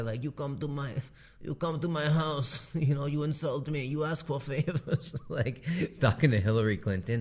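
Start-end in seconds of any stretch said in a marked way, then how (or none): 0.2-5.1 higher put-on Indian don voice; mock mafia boss holding court; Godfather-style Ambani. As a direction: play an offended Godfather with an Indian accent. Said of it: "like, the Godfather".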